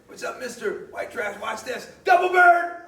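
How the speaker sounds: high, rising to a loud yell on the last words; mocking brat voice building to a shout; taunting teen. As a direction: jeer like a cocky kid showing off. Mimicking taunting kids.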